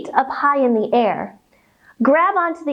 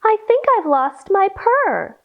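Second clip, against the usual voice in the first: kitten voice; 7 semitones higher than usual. Acted. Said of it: "she said".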